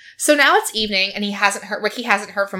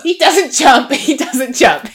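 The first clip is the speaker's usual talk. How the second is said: laughing; 5 semitones higher, 8 dB louder, slower than usual. Real, not acted. Laughing reassurance.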